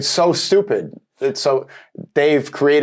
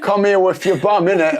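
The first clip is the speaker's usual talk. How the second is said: higher, hammed-up London accent; overdone London-accent come-on; mock London accent; 8 semitones higher, 4 dB louder than usual. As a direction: put on a cheeky London slang accent. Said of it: "Why do you say it with an accent?".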